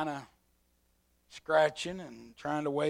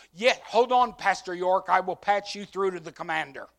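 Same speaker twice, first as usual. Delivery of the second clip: louder, higher brisk ranger; park ranger on the phone; 5 semitones higher, 11 dB louder than usual. Retold or imitated. Ranger patching the pastor through, brisk and loud. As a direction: answer briskly and loudly.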